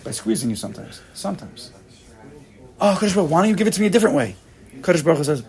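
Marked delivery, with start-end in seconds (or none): 2.8-4.4 fast, higher, pleading whine to God; hammed-up protest, rushing the words; mock complaining plea.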